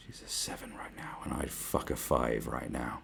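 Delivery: put-on whispered drunk confession; hushed drunk lad whisper; leering whisper. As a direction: whisper it like a sleazy aside. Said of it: "looking at her going".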